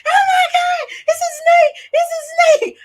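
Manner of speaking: very high, loud scream of a snake; terrified snake panic, yelled high; retold panicked scream. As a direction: shriek it out like something is after you. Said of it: "started screaming like somebody was after me".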